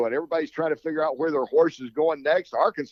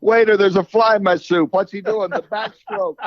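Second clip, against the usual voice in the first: hammy joke voice; 6 semitones higher, 7 dB louder than usual. Acted. Corny joke told in a voice.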